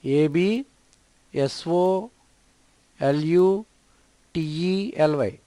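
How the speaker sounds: letters spelled out one by one, slowly; slow spelling. Shown as a demonstration. Teacher spelling absolutely for learners, slow letter by letter. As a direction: spell it out so students can write it. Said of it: "spelling the word letter by letter".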